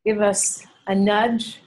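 ordinary talk

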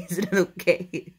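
half-laughing, amused protest. Real, not acted. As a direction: repeat it through a laugh. Repeats line while laughing.

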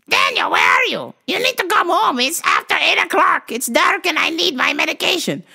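put-on high-pitched accented old mom on the phone; shrieky, insistent mock-mom voice; elderly mother caricature. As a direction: imitate a worried mother hunting for her son. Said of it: "your mom's on the line".

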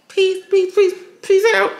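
peace, peace squeaked way up high; squeaky sign-off. Real, not acted. Vlogger signing off to her viewers, squeaky high.

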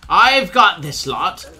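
loud, heroic battle cry; heroic shout. Acted. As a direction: bellow it like a brave warrior. Heroic rescue in game.

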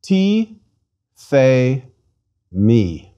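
"Ti, the, mi" one syllable at a time, long pauses between; slowly. Shown as a demonstration. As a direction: sound out "Ti, the, mi" very slowly, stepping down in pitch. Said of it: "Let's read it slowly".

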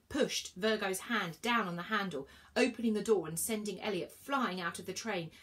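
ordinary delivery, nothing marked

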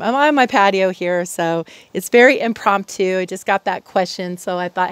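normal talking voice

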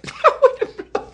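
high-pitched laugh; real amused laughter; burst of laughter. Real, not acted. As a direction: laugh out at the absurdity. Laughs at own joke.

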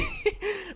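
quiet high giggle; laughing. Real, not acted. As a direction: crack up briefly, high and soft. Laughs at own "adopt me.

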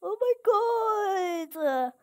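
drawn-out wailing cry, slow; anguished cry. Real, not acted. Gamer wailing as things go wrong, anguished cry. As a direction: cry out in despair at the screen. Crying out at the game.

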